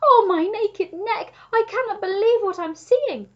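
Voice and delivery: high, loud, overwhelmed giraffe; gasping amazement. A narrator's reading. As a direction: exclaim in overjoyed disbelief. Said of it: "gasped the Giraffe".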